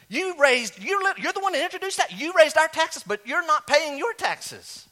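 indignant outburst. Retold or imitated. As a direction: confront the lawmaker who dodges taxes. Voters confront hypocritical lawmaker.

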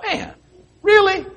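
sudden high squeak of disbelief; a high-pitched, half-laughing "really?"; incredulous thought. Retold or imitated. Watching a woman walk at a pillar, incredulous. Shocked thought at texting walker.

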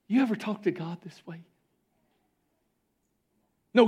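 ordinary talk

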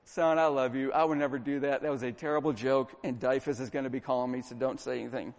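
retold tender apology to a child, hushed; heartbroken father. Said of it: "That was when my heart broke".

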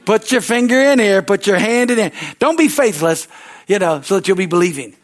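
high, loud call to Thomas; Jesus calling Thomas. Retold or imitated. Preacher voicing Jesus, calling Thomas over loudly. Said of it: "and he says".

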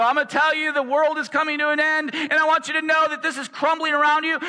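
hammed-up doom prophet scaring the crowd; alarmist doom-sayer. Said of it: "a lot of people will freak out".

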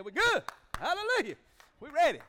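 high, loud joyful cry; excited shout. Real, not acted. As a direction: shout it out in triumph.

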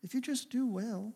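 soft, tender voice of a caring father; softly voiced loving God asking his son; gentle loving God. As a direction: coax him gently, almost hushed. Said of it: "God was loving and gentle".

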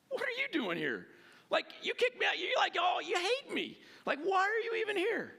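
high-pitched, indignant disbelief; incredulous Isaac. Retold or imitated. Isaac to Abimelech, who once kicked him out: high-pitched, incredulous protest.

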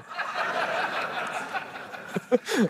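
airy burst of laughter. Real, not acted. Preacher cracking up after his German joke, laughing. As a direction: crack up at your own joke.

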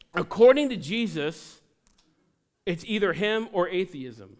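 loud, building pulpit cry; preacher rousing the congregation; full-force preaching. Real, not acted.

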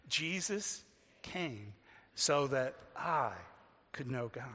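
very slow, low, phrase by phrase; measured, leaving room to join in; leading recitation. Real, not acted. Preacher leading the congregation to say that with me, slow and measured.

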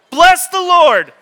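loud, high, slow yell of bless. Shown as a demonstration. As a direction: shout it at your sleeping spouse. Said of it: "Just, like, yell it out".

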